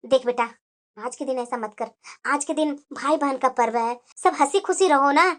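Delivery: low, soft motherly plea; calm appeasing. Acted. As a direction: quietly talk an upset daughter down. माँ नाराज़ बेटी को मनाती. (शांत, धीमी आवाज़ में समझाते हुए)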